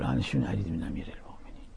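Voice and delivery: fast whispered dismissive line; whispered mock reply. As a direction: mutter it off quickly under the breath. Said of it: "يقول".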